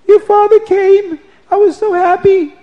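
high, loud, wailing voice of a distraught bride; tearful kala. Retold or imitated. Weeping bride tells her husband about his father. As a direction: complain through tears, pitch way up. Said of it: "she starts crying".

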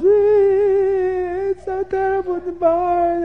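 slow, high sobbing wail; crying wail. Acted. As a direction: stretch it out like a sob. Avram crying in the barn.

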